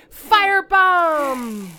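very loud, slow, high battle shout; battle cry. Acted. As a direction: bellow the attack name. Shouted attack.

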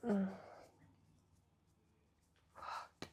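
ordinary delivery

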